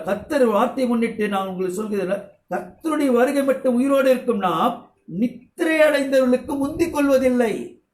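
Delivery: measured preacher's recitation of the verse; scripture reading. Acted. Reads scripture aloud.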